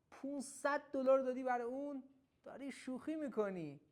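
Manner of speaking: high, loud mock disbelief; lightly acted shock at a price; incredulous. Shown as a demonstration. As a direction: raise pitch and volume in disbelief. Translates the incredulous example.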